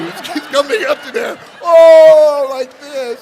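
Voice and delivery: very high loud wail as it wells up; overwhelmed crying. Retold or imitated. Overcome in the cave with Sue, a high loud wail. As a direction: let it pour out high and loud.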